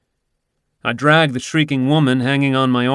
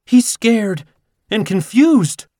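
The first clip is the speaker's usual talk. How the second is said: higher, fretful owner's voice; worried woman; 11 semitones higher, 5 dB louder, slower than usual. Acted. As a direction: fuss over the frightened cat.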